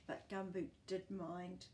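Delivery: ordinary delivery, nothing marked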